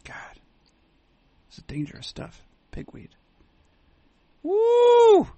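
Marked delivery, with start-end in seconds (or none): 4.4-5.3 loud, high drawn-out "woo"; high whoop. Real, not acted. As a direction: let out a long high whoop. Sudden exclamation while bantering.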